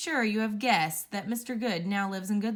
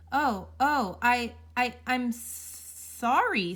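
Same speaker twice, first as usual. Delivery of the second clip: higher and louder, stumbling over the words; nervous stammer; 4 semitones higher, 4 dB louder, much slower than usual. Acted. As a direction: trip over himself in panic, dragging out sorry. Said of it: "stammered Mister Good nervously".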